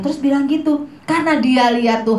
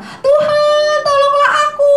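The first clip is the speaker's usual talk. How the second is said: loud, frightened shout; 4 dB louder than usual. Shown as a demonstration. Teacher shows how terrified Peter cried to the Lord, full high shout. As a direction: scream it out, terrified of drowning. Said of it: "tapi dia teriak keras-keras".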